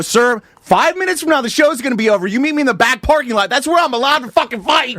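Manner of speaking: high, cocky retold challenge; high mock bravado. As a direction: accept the fight, high and cocky. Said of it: "And so I go".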